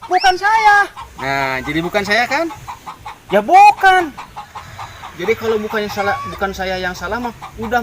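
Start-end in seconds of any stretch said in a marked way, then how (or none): 0.1-0.9 high, loud yell of blame; angry shout. Acted. Shouting at the other man that he's to blame, high yell. 3.3-4.1 high, loud snap of denial; shout. Acted. Snapping back at the other man, high loud denial.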